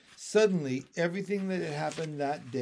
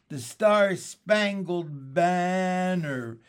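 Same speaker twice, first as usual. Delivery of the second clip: slow, louder, through giggles; laughing; 4 semitones higher, 7 dB louder, much slower than usual. A narrator's reading. Beezus laughing at her sister, drawn out. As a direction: draw it out while laughing. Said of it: "she gasped".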